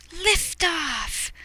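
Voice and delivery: high, thrilled cry; excited liftoff. A narrator's reading. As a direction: shout it like the rocket just lit. Launch exclamation.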